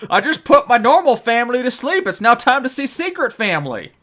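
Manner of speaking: high goofy driver voice; cartoonish cheater, high and loud; silly clown. Driver voice continued.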